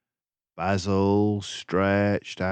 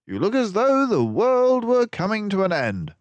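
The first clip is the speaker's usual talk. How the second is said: high, loud brisk detective voice; confident gentleman sleuth, raised pitch; Basil's voice; 12 semitones higher, 5 dB louder than usual. A narrator's reading. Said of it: "for he said".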